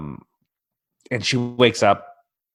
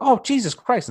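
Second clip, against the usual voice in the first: shocked; 13 semitones higher than usual. Retold or imitated. Learning spiders bite.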